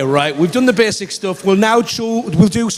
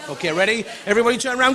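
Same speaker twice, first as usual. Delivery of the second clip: ordinary talk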